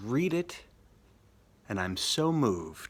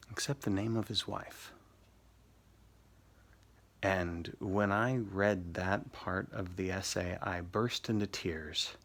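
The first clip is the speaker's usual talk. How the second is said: softer, hushed storytelling with weighty pauses; subdued, moved; 3 semitones lower, 6 dB quieter than usual. Real, not acted. Teacher recounting a student's tearful essay, subdued and moved. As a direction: let the voice sink low and soft.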